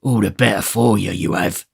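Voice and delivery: gruff retort; mild snarl; surly robber, understated; Sikes. Acted. Sikes snaps.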